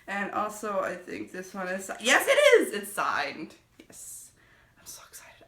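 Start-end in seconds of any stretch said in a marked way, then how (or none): 2.0-2.7 sudden high squeal of delight; realizing the book is signed, squealing high and loud; thrilled discovery. Real, not acted. Vlogger discovering her signed copy, squealing with delight. 3.8-5.4 breathless thrilled whisper; excited whisper. Real, not acted. Vlogger whispering to viewers about meeting the author, giddy. Secret thrill over signed book.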